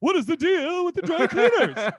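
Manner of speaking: high, loud mock stand-up delivery; jokey, high-pitched exaggerated question; hammed-up comic bit. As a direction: belt it out in a silly high voice. Hammed-up comic riff amid laughter.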